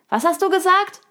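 high, loud character voice; hard-of-hearing raccoon. A narrator's reading. Raccoon who didn't catch the answer asks, high and loud. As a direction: call out the question in a high pitch.

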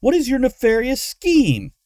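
loud, high irritated question; snapping. A narrator's reading. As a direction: snap the question out in exasperation. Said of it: "Luna snapped".

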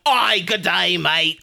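super played-up Australian accent; hammy, over-the-top Aussie greeting. Mocking fake TV accents to co-hosts, played-up Australian. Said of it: "when you hear a T V Australian".

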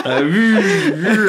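loud, high car noise through laughter; imitated engine revving. Storyteller mimics the car beside him on the roundabout, revving. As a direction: whoop like a racing engine.

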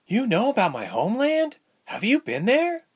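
put-on high-pitched girlish questions; eager young patient, pitched high; suddenly eager Ilse. A narrator's reading. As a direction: blurt it out, voice leaping up. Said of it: "Ilse suddenly asked".

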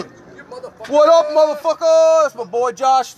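loud, high call across the room to a friend; excited shout. Real, not acted. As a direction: yell a big hello to your buddy. Greeting friend arriving mid-podcast.

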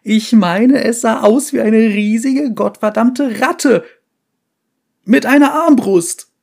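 high, loud hysterical shriek; hysterical yelling. Acted. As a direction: shriek it, losing control. Survivor yelling about giant rat.

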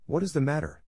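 mild eager cry. A narrator's reading. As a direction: girls crying out at once. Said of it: "cried two or three voices".

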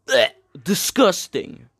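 exaggerated retch of disgust; mock disgust. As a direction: gag on it, then spit out the word. Mock revulsion at naming the IRS.

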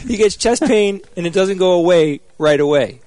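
high, odd mocking voice; character voice. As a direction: keep the silly character voice. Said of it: "I can't help myself".

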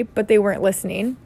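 usual speaking voice

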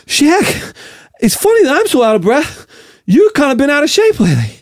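high, out-of-breath actor impression; Mark Wahlberg. Actor talking to Shaq, breathless Wahlberg voice. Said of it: "You're gonna go Wahlberg".

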